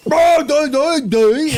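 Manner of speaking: put-on high, loud gibberish accent; mock English babble. Mocking English accents, loud nonsense babble. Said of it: "it's just like".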